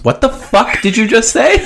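high-pitched disbelief; incredulous. Real, not acted. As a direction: demand it in baffled astonishment. Halts reading, baffled by co-host's question.

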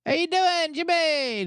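high, drawn-out call across the street; stretched, high hey Jimmy shout; yelled greeting. Retold or imitated. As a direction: call out to him from outside the bar. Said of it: "And I yelled at him".

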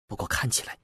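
hushed, whispered aside; low voice. A narrator's reading. Turning his head to confide in his companion, low voice. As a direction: murmur it softly and haltingly, under the breath. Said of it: "低声对包奇斯说".

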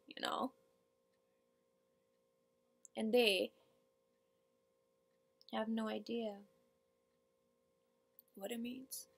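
monologue character, deflated. Acted.

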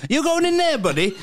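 high, laughing put-on voice; put-on questioner. Colleague asking Max at the office door, high put-on voice. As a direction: pitch it way up, half laughing.

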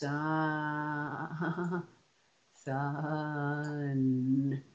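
"Sun" sounded out slowly, s-u-n, each sound held; slowly stretched. Shown as a demonstration. Child finally stretching the word correctly, slow and even. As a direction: draw out every sound of "Sun". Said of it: "they will finally get it".

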